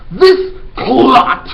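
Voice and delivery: huge high boom; booming insult. Acted. Headmistress booming an insult at the boy. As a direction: boom it out with contempt. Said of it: "boomed the headmistress".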